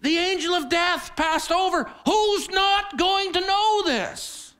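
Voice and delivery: high, loud preacher's cry, the second line stretched slow; full-force preaching. Acted. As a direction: proclaim it to the congregation. Preaching opener, raised voice.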